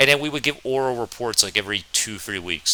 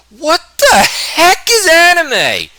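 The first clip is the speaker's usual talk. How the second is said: much higher, louder, slow scornful sneer; mocking classmate; 9 dB louder, much slower than usual. Classmate scoffing at the new kid, high slow sneer. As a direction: stretch it out like a sneering bully.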